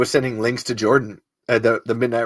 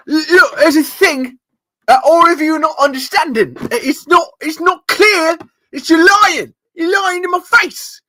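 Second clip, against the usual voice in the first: much higher, loud accusing voice; Cal Lightman impression; 6 dB louder than usual. Podcaster as the TV lie detector, loud and much higher. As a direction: accuse them, reading every lie. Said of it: "I'm Cal Lightman".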